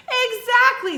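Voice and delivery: high loud exactly; excited shout. Acted. Jack confirming the jug guess to the Queen, thrilled. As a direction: cheer it like a winning guess.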